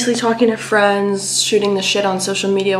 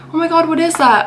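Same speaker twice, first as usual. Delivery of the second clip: mock shocked passerby; 9 semitones higher, 5 dB louder than usual. Passersby gawking at the raccoon.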